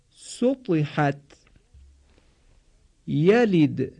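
short i close to 'ee' in سطحت and یلد; Arabic kasra demo. Shown as a demonstration. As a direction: model سطحت، یلد one word at a time with falling endings. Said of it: "لطفاً به این مثال ها توجه کنید". (تلفظ کسرهٔ عربی شبیه «ای» در «یلد»)

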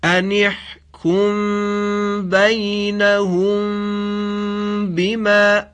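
slow, drawn-out chanted Quran recitation with nasal ghunna. Shown as a demonstration. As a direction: chant it slowly, holding the mim. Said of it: "توجه کنید". (تلاوت آهسته و کشیده قرآن با غنه)

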